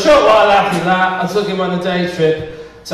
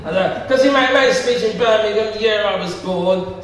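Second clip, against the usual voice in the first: ordinary talk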